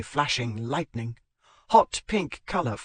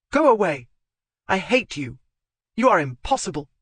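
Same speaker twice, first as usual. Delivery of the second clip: angry dismissal; 6 semitones higher, 5 dB louder than usual. Acted. Zoe dismissing Marchmont in anger.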